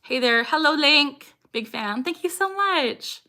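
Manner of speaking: normal talking voice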